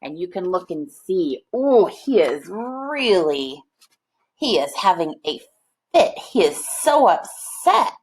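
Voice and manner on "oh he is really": usual speaking voice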